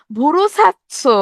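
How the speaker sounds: slow, drawn-out Barishal village speech for ভুরু ছাড়ছো; Barishal dialect. Retold or imitated. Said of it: "বরিশালের ভাষায় বলে".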